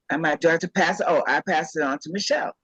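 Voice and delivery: ordinary talk, nothing marked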